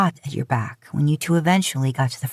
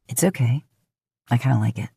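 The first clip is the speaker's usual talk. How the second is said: lower, playful male voice; teasing; 4 semitones lower, faster than usual. A narrator's reading. Dean teasing his rambling date, lightly. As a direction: tease her gently about the rambling. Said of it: "he teases".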